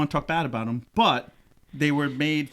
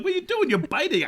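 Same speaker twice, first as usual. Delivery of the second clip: mock high scolding. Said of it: "I'm like".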